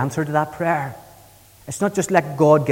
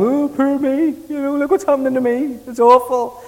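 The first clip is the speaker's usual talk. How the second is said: high, whiny mock lament, hammed-up; mock self-pity; 9 semitones higher than usual. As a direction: mimic someone moaning "poor me". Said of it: "That's not why he's weeping".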